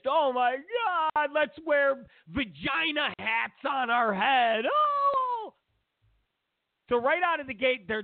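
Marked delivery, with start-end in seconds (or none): 0.1-4.6 high, slow ditzy millennial voice; mock the airheaded protester; lightly hammed-up millennial gushing. 4.7-5.5 sudden high squeal; mock squeal. Mock squeal after quote.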